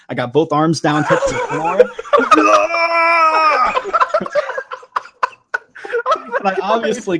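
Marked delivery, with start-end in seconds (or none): 1.9-5.3 loud, high burst of real laughter; cracking up at her own bathroom routine; big laugh. Real, not acted. Telling friends her toilet routine, bursting into loud laughter.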